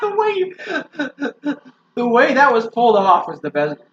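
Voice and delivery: high, loud, breaking up with laughter; can't get the sentence out for laughing; laughing hard. Real, not acted. Cracking up at friend's boast.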